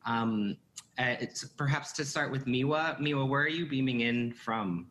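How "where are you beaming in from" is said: ordinary talk, nothing marked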